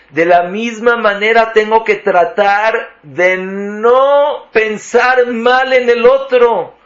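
agudo y fuerte, predicando con fervor; predicación enfática. Acted. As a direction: clamar la lección con pasión. Predica con fuerza creciente. (predicador exhortando con fervor a valorar al otro)

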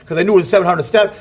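usual speaking voice

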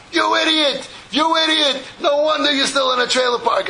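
high-pitched retold angry yell; mock screaming. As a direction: berate him in an acted, high-pitched outburst. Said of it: "he starts screaming at Leib".

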